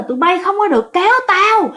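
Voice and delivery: a man gripping a pillar shouting to be let jump; possessed man yelling. Retold or imitated. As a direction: bellow at the crowd not to pull him down. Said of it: "Rồi ổng mới la".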